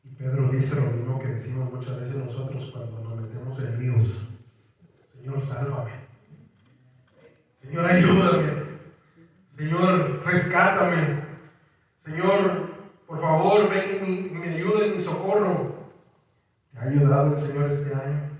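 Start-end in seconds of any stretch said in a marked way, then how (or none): 5.0-15.7 louder, urgent pleading; pleading cry for help. Retold or imitated. Drowning Peter begs the Lord for rescue, louder pleading. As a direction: beg the Lord to rescue you.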